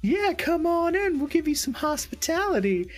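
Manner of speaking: very high put-on coy woman's voice; flirty lady. Scantily clad lady inviting player inside, mock coy high voice. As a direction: lure him in, high and sugary. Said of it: "she's just like".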